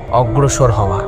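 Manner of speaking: ordinary delivery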